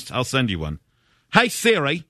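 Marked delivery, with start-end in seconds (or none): 1.3-2.0 higher-pitched, shaky attempt at Irish; attempted Irish accent. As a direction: call out in a badly faked Irish accent. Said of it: "I thought you said yours is Irish".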